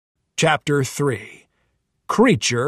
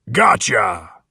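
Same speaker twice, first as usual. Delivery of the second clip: put-on spring out on the boys with a boom; deep booming gotcha; 6 semitones lower than usual. Swamp monster grabbing the boys from behind, booming. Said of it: "boomed a deep voice".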